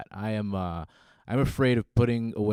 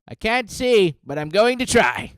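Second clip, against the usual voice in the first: put-on high, loud old-lady holler; shrieky mock granny battle cry; elderly woman; 11 semitones higher, 7 dB louder than usual. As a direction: call out boldly as a hammed-up old woman. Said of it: "you hear a woman go".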